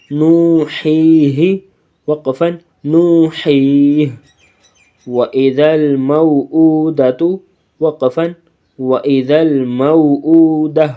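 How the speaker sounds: slow, melodic recitation of وَإِذَا الْمَوْءُودَةُ; Quran recitation. Shown as a demonstration. Teacher recites the verse slowly for the pupils. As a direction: intone it slowly like tajweed recitation. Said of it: "تو repeat کر لیتے ہیں".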